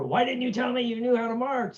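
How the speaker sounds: imitating a scolding sergeant, pitched high; half-hearted yell, demanding an answer; mock yelling. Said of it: "I got yelled at for".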